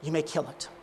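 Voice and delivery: acted hushed resignation; desperate surrender. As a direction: give in quietly after a long pause. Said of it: "in a final act of desperation".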